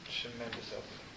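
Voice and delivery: preacher repeating it quietly; soft echo. Shown as a demonstration. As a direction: let the echo fall to a murmur. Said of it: "You know what's happening".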